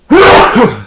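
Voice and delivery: loud sudden sneeze; sneezing. Real, not acted. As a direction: sneeze hard into the mic. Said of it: "Sorry, I'm gonna sneeze".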